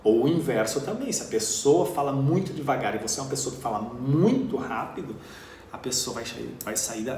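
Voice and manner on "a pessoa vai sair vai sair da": ordinary talk, nothing marked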